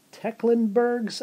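high, slow, doubtful question; unsure guess. Real, not acted. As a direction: test the name as if unsure it's right. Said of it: "I'm not sure".

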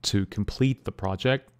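ordinary delivery

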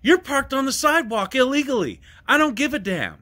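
high, heated retort to the woman; retold angry yell. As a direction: fire it back at her. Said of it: "I was like".

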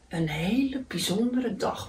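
soft, understated grumble; grumbling. A narrator's reading. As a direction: grumble it lightly to yourself. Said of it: "mopperde hij". (zachtjes mopperend)

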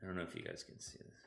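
fast soft whisper; hurried hushed aside. Real, not acted. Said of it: "Now I have to whisper".

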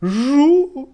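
crying buzz. A narrator's reading. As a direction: wail it like a crying beetle. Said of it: "заплакав Жук". (плач жука)

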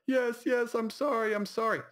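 high-pitched, hammed-up weepy apology; mock hysterical crying. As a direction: imitate a blubbering drunk on the phone. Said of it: "he's so belligerently crying".

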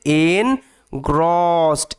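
"engrossed" slowly as en... grosst; broken into syllables. Shown as a demonstration. Teacher demonstrating the -ed ending, "engrossed" as en... grosst. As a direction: split "engrossed" in two, no vowel in the ending. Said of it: "এটার উচ্চারণটা হচ্ছে".

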